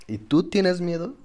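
high, slow put-on reporter voice; mock interviewer. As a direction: play a hammed-up TV reporter. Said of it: "la reportera, lo que sea, me preguntaba".

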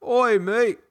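high, loud mock-Cockney hail; bad Cockney accent. Shown as a demonstration. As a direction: call out in a hammed-up American Cockney. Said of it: "Cockney accent".

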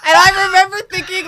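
excited retelling. Real, not acted. Retelling with amusement.

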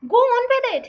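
high, loud, impatient urging; urgent hiss. Acted. Mother goading her reluctant husband, urgent and loud. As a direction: push him on sharply. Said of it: "hissed the mother".

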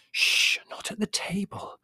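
a slow, urgent whisper; slow scolding whisper to the children. A narrator's reading. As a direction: hush them at the table. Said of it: "whispered Mother".

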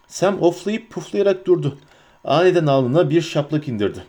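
ordinary delivery, nothing marked

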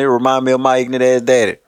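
ordinary talk, nothing marked

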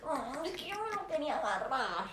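drawn-out, mock whining to a friend; comic tripping-out whine. Whining to Dani about a guy, mock tripped-out voice. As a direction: imitate your own drunken moping, stretching the words. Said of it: "empezó a hablar como".

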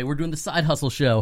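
ordinary talk

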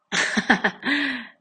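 short high laugh; laughing at her own joke. Real, not acted. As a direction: burst into a laugh.

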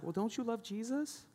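high, mocking guilt-trip voice; mock pushy preacher. Pastor mocking a pushy preacher guilting givers, high mock voice. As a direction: imitate a pushy church guilt-tripper. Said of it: "maybe you heard these things in your past".

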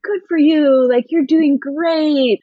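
high, drawn-out cheering-on voice; imitated encouragement. People praising an American who is learning French, gushing mock encouragement.

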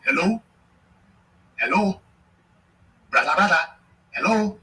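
high, loud, rising "Hello?" into a dead line; mock desperate phone call. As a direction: call out again and again, hammed-up and ignored. Mocking caller left hanging.

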